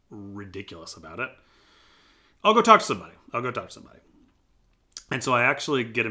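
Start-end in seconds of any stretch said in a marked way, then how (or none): 2.4-3.1 loud, high burst of resolve; plain retelling of the same line is the contrast; exclaimed. Retold or imitated. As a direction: blurt it out as a sudden decision. Exclaimed decision, repeated.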